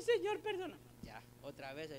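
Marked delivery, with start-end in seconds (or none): 0.0-0.8 high, loud, whiny plea; mock pleading prayer. Preacher voices the sinner asking pardon again, mock pleading. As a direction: toss off a half-hearted plea for pardon. Acted repeat prayer, second sin.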